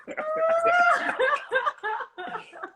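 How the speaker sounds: long, very high squealing laugh; helpless high-pitched laughing fit; high laughter. Real, not acted. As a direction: burst into real, high giggles. Cracks up at wrong guesses.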